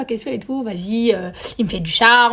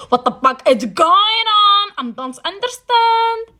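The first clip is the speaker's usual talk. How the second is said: high, slow, stunned English outburst; high slow shocked cry, like catching them red-handed; shocked disbelief; 10 semitones higher, much slower than usual. Retold or imitated. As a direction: drag it out in disbelief. Shocked at seeing them together.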